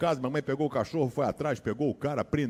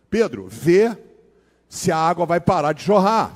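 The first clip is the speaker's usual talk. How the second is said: louder call to Pedro across the house; 7 dB louder than usual. Retold or imitated. Mother calls from the porch for Pedro to check the drain, loud. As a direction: call out to her husband over the water. Mother calling to husband, flooding.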